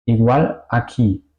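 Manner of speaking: normal talking voice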